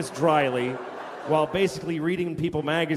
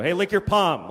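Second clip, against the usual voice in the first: abrupt, full-voiced mock request; blunt demand; 4 dB louder than usual. Shown as a demonstration. Husband asking his wife too directly, blunt demonstration. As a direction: blurt it out as a tactless order. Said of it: "You can't just go".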